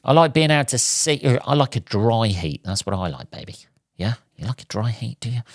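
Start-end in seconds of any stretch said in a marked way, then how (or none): 2.6-3.6 sultry tease. Pretend. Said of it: "I don't know why I said it like that". 4.4-5.4 mock sultry murmur. To the viewers, joking about saunas, a mock seductive murmur. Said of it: "Like I'm doing porn A M, A S M R".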